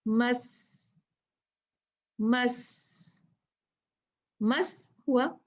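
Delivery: मस with the स held as a stop; doubled sound held; stopped on the doubled letter. Shown as a demonstration. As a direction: stop on मस and hold the hiss of स. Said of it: "मस्सी को कैसे पढ़ेंगे?".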